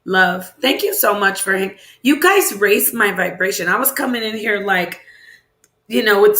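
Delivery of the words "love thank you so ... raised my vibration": normal talking voice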